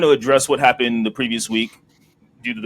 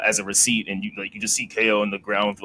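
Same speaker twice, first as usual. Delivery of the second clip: ordinary talk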